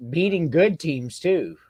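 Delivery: normal talking voice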